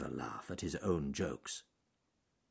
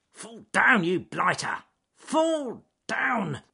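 loud, cross growl at the tree; bad-tempered muttering; 13 semitones higher, 6 dB louder, slower than usual. A narrator's reading. Ben the beaver gnawing at a tree trunk, cross and irritable. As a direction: snarl at the tree between bites. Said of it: "he muttered between bites".

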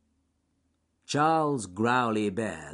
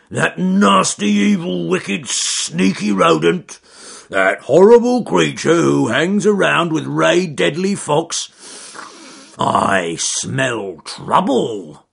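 angry bear; 9 semitones higher, 13 dB louder, slower than usual. Acted. Bear rants about weasel.

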